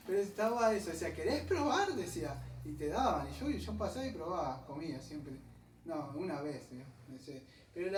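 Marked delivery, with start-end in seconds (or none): 1.1-1.9 high, bright sample-seller's invitation, rising; a street vendor coaxing a passer-by to try; offering a taste. Retold or imitated. Vendor calling out to passers-by, high and coaxing.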